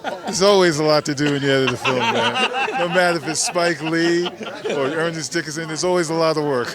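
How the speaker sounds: loud, laughing reply. Real, not acted. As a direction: laugh through the answer. Laughing reply about editing Spike.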